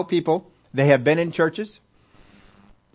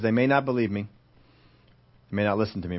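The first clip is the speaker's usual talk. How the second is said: doubtful; 6 semitones lower, 5 dB quieter, faster than usual. Acted. Voicing Moses's doubtful reply.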